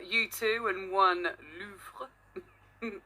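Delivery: slow, hammed-up French accent on "Louvre"; pantomime French accent. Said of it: "pantomime French accent".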